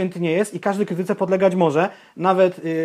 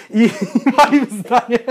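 laughing; 7 semitones higher, 4 dB louder, slower than usual. Real, not acted. Laughs at his own verdict.

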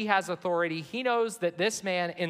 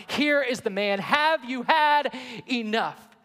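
louder and higher, slowing into a plea; pleading plea for sympathy; 6 semitones higher, 5 dB louder than usual. Retold or imitated. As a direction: beg the crowd to be satisfied. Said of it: "feeble attempt to get sympathy".

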